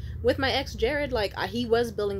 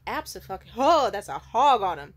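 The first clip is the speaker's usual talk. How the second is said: emphatic exclamation; 8 dB louder than usual. Real, not acted. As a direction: lean into it. Boasting about size.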